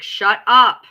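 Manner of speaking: sudden loud snap of shut up; shouted shut up. Retold or imitated. Telling her to shut up, a loud sudden snap. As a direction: bark it out to cut her off. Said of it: "I was just like".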